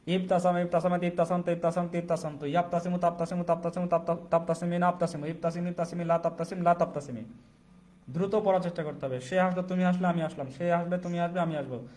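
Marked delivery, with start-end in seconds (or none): 0.1-7.2 quick run through the list; fast recitation. Shown as a demonstration. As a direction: rattle the forms off quickly. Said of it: "দ্রুত পড়ার চেষ্টা করবেন".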